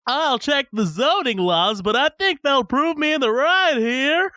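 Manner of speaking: high, slow prissy know-it-all; nerdy neighbor voice. Retold or imitated. Quoting Phil's nerdy reply.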